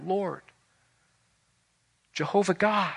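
ordinary delivery

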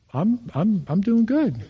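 high-pitched, stammered mock reassurance; sheepish reply. Pastor caught off guard by question.

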